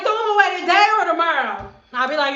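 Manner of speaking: ordinary talk, nothing marked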